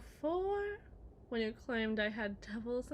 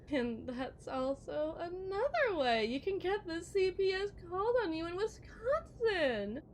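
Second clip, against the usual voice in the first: ordinary talk, nothing marked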